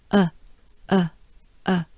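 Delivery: uh as a rounded French eu vowel; short, separate rounded uh; jaws pushed forward. Shown as a demonstration. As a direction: push the lips forward for each uh. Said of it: "It's a forward movement of your jaws".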